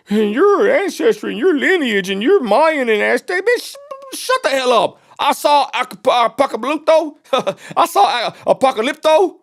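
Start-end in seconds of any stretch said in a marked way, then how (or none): none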